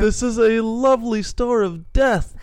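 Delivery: high, loud, gushing mock delight; mock awe. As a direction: gush over the store like a giddy visitor. Mocking visitors at death store.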